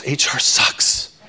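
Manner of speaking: slow, hushed, unvoiced jab at HR; whispered aside. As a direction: drop to a conspiratorial whisper. Blunt aside about HR.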